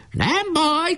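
very high, defiant call; shouting. A narrator's reading. Bombed boy answers from the fort, high shout. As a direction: cry it out high, volume held back. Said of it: "kiáltotta".

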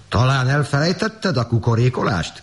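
high, indignant farmer's question; indignant call. A narrator's reading. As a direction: call out in disbelief without much added volume. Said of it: "kiáltott rá a gazda".

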